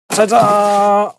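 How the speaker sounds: high, mock-fanfare ta-da; playful reveal. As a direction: present it like a magic trick.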